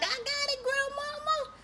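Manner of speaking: put-on high, soft child answering; small voice giving in to grandma; meek kid reply.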